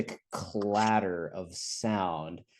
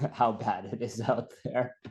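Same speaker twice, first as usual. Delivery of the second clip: higher-pitched, talking through laughter; laughing; 4 semitones higher than usual. Retold or imitated. Telling friend about Troll's verdict on Medford, laughing. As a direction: crack up while delivering the line. Cracking up at own anecdote.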